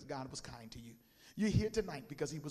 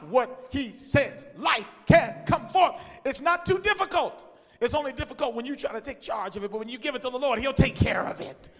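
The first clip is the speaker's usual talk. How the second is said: rising shouted preaching climax; 6 semitones higher, 14 dB louder than usual. Real, not acted. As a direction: build to a roaring peak.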